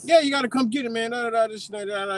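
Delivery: high-pitched rapid-fire imitation; high mimicked motormouth, trailing into filler; mock fast talking. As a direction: babble quickly like an agitated caller. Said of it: "just fast talking".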